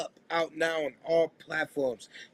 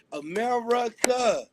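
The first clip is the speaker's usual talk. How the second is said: drawn-out call; 5 semitones higher, slower than usual. Acted. Radio host signature call.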